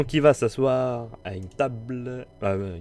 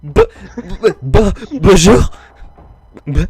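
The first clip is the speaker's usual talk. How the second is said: high, loud stammered hello; stuttering greeting; 9 semitones higher, 16 dB louder than usual. Acted. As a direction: stammer out the bonjour. Shy NPC stammering greeting.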